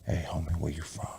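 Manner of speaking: low, hushed menacing greeting; a soft, low gangster murmur; real low. Retold or imitated. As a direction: mutter it under your breath like a street threat. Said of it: "They talk to me real low".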